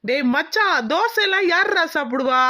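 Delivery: high, emphatic scoffing; insistent. Acted. Friend assuring his pal that she likes poori, insistent.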